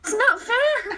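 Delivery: whiny. Real, not acted. Whining about rolls.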